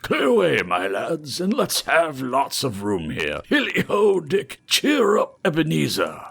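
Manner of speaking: put-on rich, fat, jovial old master calling out loud. Fezziwig calls his apprentices, jovial and loud. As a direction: boom it out like a merry fat employer. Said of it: "jovial voice".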